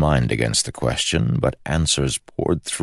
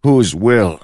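loud, high defiant challenge; challenging; 9 semitones higher, 9 dB louder than usual. Acted. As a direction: throw the challenge back hard. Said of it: "challenged Holland".

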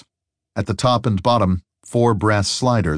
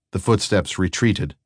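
plain reading voice

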